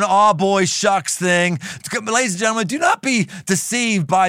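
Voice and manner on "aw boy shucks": loud hammed-up Southern drawl "Aw, boy, shucks"; mock country boy Southern accent; down home Southern. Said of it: "like, down home Southern".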